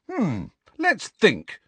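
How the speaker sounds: slow, high, drawn-out pondering; mock thoughtful. A narrator's reading. Student Harry answering professor sarcastically, mock thoughtful. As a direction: pretend to ponder, stretching it out. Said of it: "in a mock thoughtful voice".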